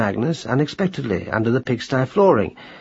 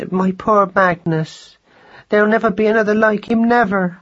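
much higher, pleading mouse lament; grieving mother mouse; 10 semitones higher than usual. A narrator's reading. Madeline wailing over her lost son, high. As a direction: let the grief climb high. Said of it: "mother mouse laments".

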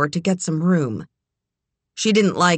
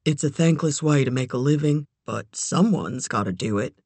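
lower voice. A narrator's reading. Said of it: "his voice just sweeping a lower register".

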